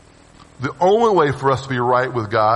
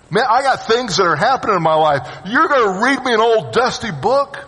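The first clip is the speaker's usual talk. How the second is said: higher, fed-up complaint; exasperated scoffer; 8 semitones higher than usual. Retold or imitated. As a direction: throw up your hands at the preacher. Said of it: "The scoffer is the one who's going".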